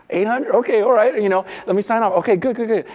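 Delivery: high-pitched, flustered agreement; eager acceptance. Acted. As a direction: act out a delighted debtor grabbing the deal. Debtor eagerly accepts the offer.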